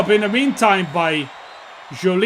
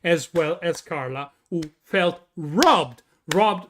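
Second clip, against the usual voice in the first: normal talking voice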